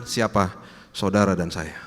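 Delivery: normal talking voice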